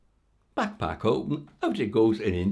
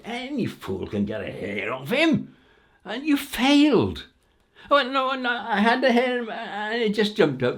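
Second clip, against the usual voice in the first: heated row; 14 semitones higher, 8 dB louder than usual. Acted. King rages, queen protests.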